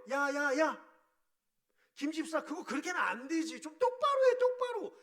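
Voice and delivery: loud, high scolding that builds to a shout; scolding yell. Shown as a demonstration. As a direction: bark at the deacon to do it right. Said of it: "이렇게 물론 안 하시겠지만요". (집사를 꾸짖는 높고 큰 고함)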